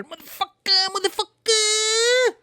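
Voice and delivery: mock outraged squeal. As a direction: squeal each word, stretched out slowly. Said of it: "I'm just going".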